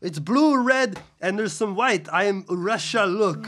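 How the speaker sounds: loud, high Russian-accent impression; Alex Ovechkin. Co-host mimics hockey star showing off his bruise, loud high Russian accent. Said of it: "That's how he talks".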